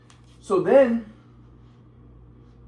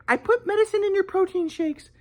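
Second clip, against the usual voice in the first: imitating mom; 11 semitones higher than usual. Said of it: "she was like".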